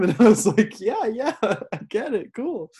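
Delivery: retelling through real laughter, pitched high; can't hold back the laughs; laughing. Real, not acted.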